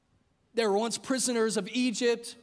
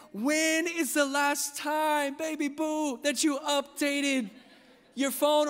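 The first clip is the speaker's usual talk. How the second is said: teasing husband; 5 semitones higher, slower than usual. Retold or imitated. Pastor teasing wife, baby boo.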